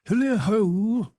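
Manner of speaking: put-on a rich, fat, jovial old master calling out; jovial Fezziwig voice. A narrator's reading. Old Fezziwig calls to his apprentices, jovial and loud. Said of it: "cried old Fezziwig".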